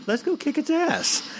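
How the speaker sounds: high hammed-up tough-guy kangaroo; mock kangaroo gang. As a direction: pitch way up like a cocky kangaroo picking a fight. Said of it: "they're like".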